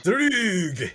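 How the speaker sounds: high, hammed-up Scottish burr; Scottish accent. Echoing a friend's most Scottish word, exaggerated Scottish. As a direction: push the mock Scottish accent right up high. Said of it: "that's the most Scottish word".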